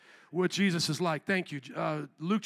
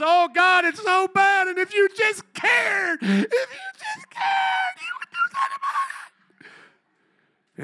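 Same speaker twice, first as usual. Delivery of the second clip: very high, loud, slow crying whine breaking off at the end; hammed-up sobbing plea to God; mock wailing prayer; 13 semitones higher, 4 dB louder, slower than usual. Mocking worried wailing prayer.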